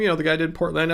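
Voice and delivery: normal talking voice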